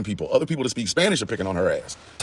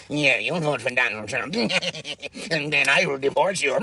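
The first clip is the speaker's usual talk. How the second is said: slow sinister voice with giggles; mock Stephen Miller taunt, laughing; Stephen Miller impression; 4 semitones higher, slower than usual. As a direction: slowly gloat like a villain. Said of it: "that was Stephen Miller".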